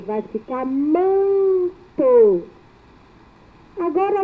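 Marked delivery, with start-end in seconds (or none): 0.1-2.4 a teacher stretching "manto" to show its nasal sound; stretched demo word. Shown as a demonstration. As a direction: slowly draw out "vai ficar manto", leaning on the nasal in "manto".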